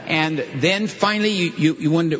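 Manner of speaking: usual speaking voice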